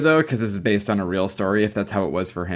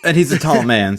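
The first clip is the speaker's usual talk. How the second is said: laughing; 5 semitones higher, 8 dB louder than usual. Real, not acted. Laughing while adding to the joke.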